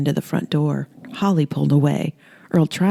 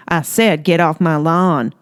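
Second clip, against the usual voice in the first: slow, drawn-out yell; slow angry yell; 4 dB louder, slower than usual. Acted. Holly shooting the creature, slow angry yell. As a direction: bellow it at the monster. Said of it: "and yelled".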